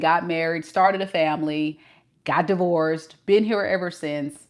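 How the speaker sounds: usual speaking voice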